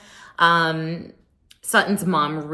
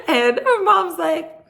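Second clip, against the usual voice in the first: laughing; 3 dB louder, slower than usual. Real, not acted. Laughing at scene.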